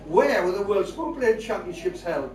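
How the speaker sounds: ordinary talk, nothing marked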